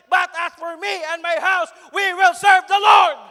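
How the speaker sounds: loud, high, slowing declaration; thundering pulpit shout; shouted preaching climax. Real, not acted. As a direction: proclaim the verse at the top of your voice. Climax of sermon, verse repeated.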